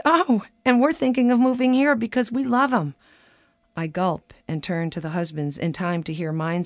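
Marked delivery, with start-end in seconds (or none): 0.0-3.0 higher, louder mock enthusiasm; imitated gushing guest. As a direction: gush like a delighted dinner guest. Voices enthusiastic Canadian dinner guest.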